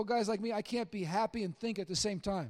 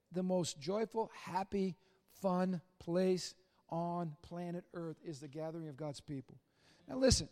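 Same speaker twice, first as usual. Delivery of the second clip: normal talking voice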